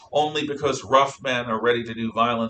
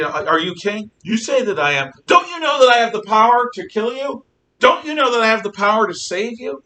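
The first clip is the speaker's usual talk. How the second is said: high, loud challenging demand; Pilate's threat; 11 semitones higher, 9 dB louder than usual. Acted. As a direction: press him with power over life.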